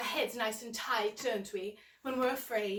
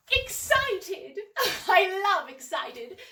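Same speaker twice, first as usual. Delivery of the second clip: Excited said much higher and louder, laughing; 5 semitones higher, 7 dB louder, slower than usual. Acted. Host announcing the next feeling card, excited and laughing. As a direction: burst with glee at the word.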